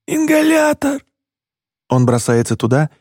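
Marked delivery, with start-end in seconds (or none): 0.1-1.0 wheezing. Acted. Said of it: "сиплю я".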